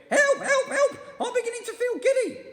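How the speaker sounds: terrified and confused; panicked shriek. A narrator's reading. Missus Twit shrieking that they stand on the ceiling.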